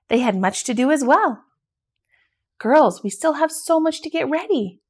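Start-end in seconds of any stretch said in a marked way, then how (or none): 2.6-4.8 a little worried. A narrator's reading. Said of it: "sounded a little worried".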